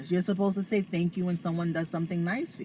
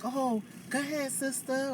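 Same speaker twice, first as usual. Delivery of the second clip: higher, softer friendly Southern shopper; 8 semitones higher, 5 dB quieter than usual. Retold or imitated. Atlanta shoppers letting her friend go first, softly higher. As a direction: lightly voice a sweet stranger letting you pass.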